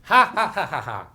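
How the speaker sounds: loud mocking witch cackle; evil villain laugh that starts loud and drops. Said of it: "The witch laughed".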